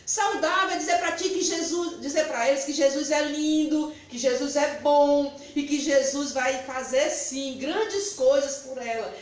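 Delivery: usual speaking voice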